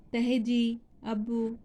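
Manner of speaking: ordinary talk, nothing marked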